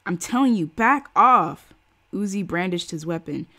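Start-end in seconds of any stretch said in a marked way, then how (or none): 0.1-1.7 loud, rising threat; threatening shout. Acted. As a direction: warn them off with a gun. Uzi threatens the crowd.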